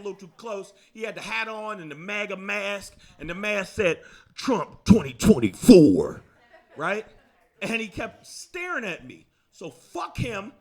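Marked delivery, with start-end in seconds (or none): 4.3-6.2 mock rally announcer. Reading the mask's slogan aloud.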